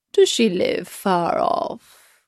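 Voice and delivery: slow, drawn-out wolf voice. A narrator's reading. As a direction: play the wolf, asking slowly. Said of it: "said the wolf".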